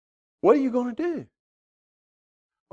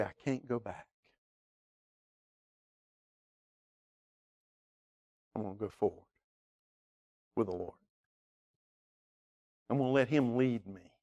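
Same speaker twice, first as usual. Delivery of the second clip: quiet vow; 8 semitones lower, 14 dB quieter, faster than usual. Pretend. A believer resolving to leave Egypt, hushed vow. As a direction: say it like a private promise to God.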